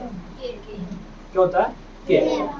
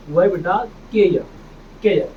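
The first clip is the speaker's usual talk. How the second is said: normal talking voice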